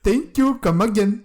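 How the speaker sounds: high, loud caricature of an Indian shopkeeper; mock Indian accent. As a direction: chirp it in a hammed-up Indian accent. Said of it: "always talking like this".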